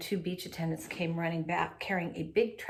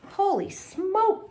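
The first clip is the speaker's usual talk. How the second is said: high, slow amazed exclamation; astonished gasp; 12 semitones higher, 4 dB louder, much slower than usual. A narrator's reading. Beach attendant stunned by the seaweed dog, gasping high. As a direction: gasp in disbelief. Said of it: "one of them gasped".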